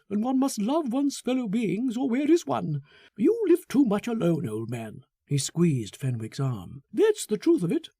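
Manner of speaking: high, quick, cloying friendliness; a smug man pressing friendship on him; Foster, gushing and sentimental. Acted. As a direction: Foster fawns over Fenwick, high and fast.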